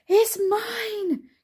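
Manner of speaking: high breathless claim; gasping. A narrator's reading. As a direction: gasp it out, out of breath from chasing. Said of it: "gasped Mister Brown".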